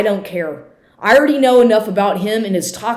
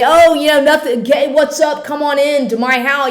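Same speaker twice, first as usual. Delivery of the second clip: much higher, louder sarcastic sing-song; mocking the idea of letting him back in; mock cheerful welcome; 7 semitones higher, 4 dB louder than usual. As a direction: fake breezy greeting, dripping sarcasm. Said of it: "does he really think that I'm just gonna say".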